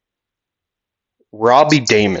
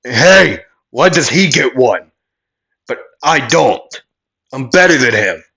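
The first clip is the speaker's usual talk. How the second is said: loud, indignant yell, pitched up; Bakugo; 4 semitones higher, 5 dB louder than usual. Acted. Bakugo protesting that Midoriya got a license first, yelling. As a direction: yell it like a jealous rival. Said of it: "Bakugo would yell".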